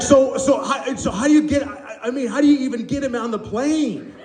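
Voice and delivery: high, stumbling mock amazement; baffled wonder. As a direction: stammer out naive questions in fascination. Acting baffled, fascinated woman.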